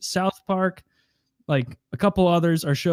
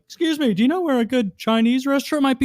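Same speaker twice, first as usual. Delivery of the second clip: higher, polite put-on voice; imitating the character; 10 semitones higher, 4 dB louder than usual. Friend quoting the Seinfeld bit, voicing the polite question. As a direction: ask it politely in his voice. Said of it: "And he's like".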